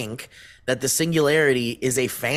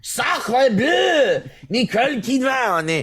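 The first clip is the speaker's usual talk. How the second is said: mock French exclamation; 11 semitones higher, slower than usual. Asked for a French accent, he yells in mock French. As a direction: shout it like a cartoon Frenchman. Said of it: "Can you do it in a French accent?".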